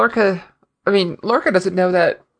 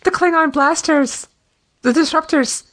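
excited; 7 semitones higher than usual. Real, not acted. Excited about weapons.

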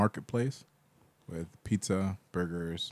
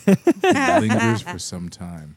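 slow, loud, dramatic warning; slow loud emphasis; 2 semitones higher, 11 dB louder, slower than usual. Acted. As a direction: drag it out loud and heavy. Describing toxic fart.

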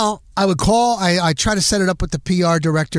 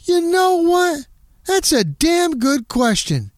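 slow and higher, a mock Ditka; impressed coach; 11 semitones higher, slower than usual. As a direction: drag it out, grudgingly impressed. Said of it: "And he goes".

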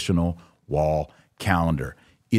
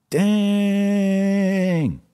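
a sudden, much higher exclamation; stunned dang; 13 semitones higher, 3 dB louder than usual. Real, not acted. Host reacting to servants of Satan quote, stunned high exclamation. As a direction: let it burst out high in disbelief. Reacting to Vigano's harsh words.

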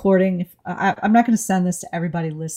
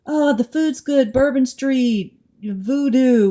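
mock tourist; 6 semitones higher, slower than usual. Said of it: "you know".

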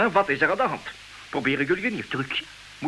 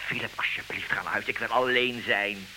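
much softer and lower, tired pleading; weary plea; 8 semitones lower, 6 dB quieter than usual. Acted. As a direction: ask him to go, drained. Weary husband begs friend leave.

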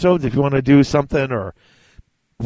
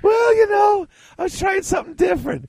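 defensive laugh-shout. Real, not acted. Defending his odd slogan delivery.

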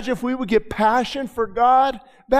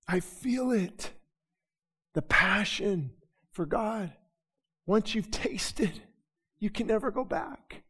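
quiet sincerity; 9 dB quieter than usual. Real, not acted. Sudden drop after loud preaching.

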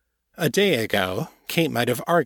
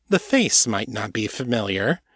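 ordinary delivery, nothing marked

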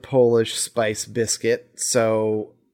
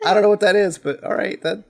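higher, bright put-on yeah cool, faking that she knows it; pretending to be into it, voice pitched way up; mock enthusiastic agreement; 9 semitones higher than usual. Retelling her fake excited reaction to masquerade, higher-pitched mock enthusiasm. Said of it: "I was like".

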